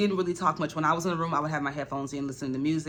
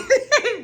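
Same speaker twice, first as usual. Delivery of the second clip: real burst of high laughter; 9 dB louder than usual. Real, not acted. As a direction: crack up at the memory. Laughing at her own story.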